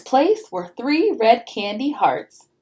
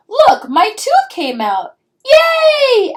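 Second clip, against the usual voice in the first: high, loud cheer over a lost tooth; excited child; 13 semitones higher, 7 dB louder, much slower than usual. A narrator's reading. Child showing Mommy her tooth, excited. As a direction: squeal with delight, slow on the first words.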